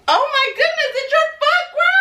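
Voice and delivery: high surprised exclaiming; amazed. Real, not acted. Mom marvels to her daughter about the small socks, high amazed voice. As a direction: marvel at how her foot grew.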